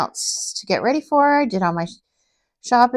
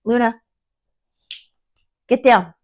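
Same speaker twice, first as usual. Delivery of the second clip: real sharp command to a pet, raised voice; firm raised-voice scolding; calling to pet; 5 semitones higher, 5 dB louder than usual. Real, not acted. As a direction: call out higher and louder to shoo it down. Calls pet off the craft table.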